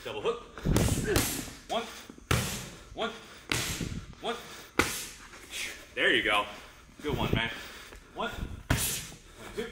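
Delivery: boxing coach calling combos. Acted.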